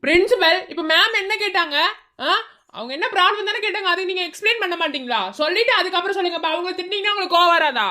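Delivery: high, heated talking back; indignant retort. Acted. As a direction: argue back at the principal, worked up. Student argues back at principal.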